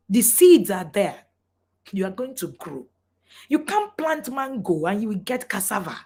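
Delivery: usual speaking voice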